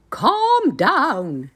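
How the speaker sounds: very high squeaky giantess. A narrator's reading. Giantess hushing her husband, high and squeaky. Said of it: "said the giantess".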